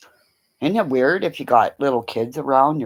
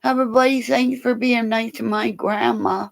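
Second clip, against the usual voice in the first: dog's whine; 9 semitones higher than usual. Pretend. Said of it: "you gonna whine and say".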